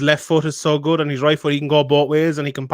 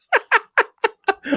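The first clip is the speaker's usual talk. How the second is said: high gloating laugh. Real, not acted. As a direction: giggle up high. Gloating about bet.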